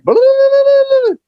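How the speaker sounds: high, loud rolling "blah-blah" holler; long high trilled call, imitated; imitated answering yell. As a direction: mimic a man yelling back from a hilltop. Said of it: "the man yells back".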